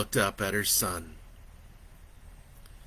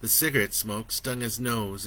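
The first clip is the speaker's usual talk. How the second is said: ordinary delivery, nothing marked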